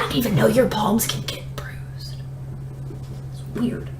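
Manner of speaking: soft whisper to yourself in disbelief; whispered aside. Real, not acted. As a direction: drop to a breathy aside, almost unvoiced. Quiet aside about bruised palm.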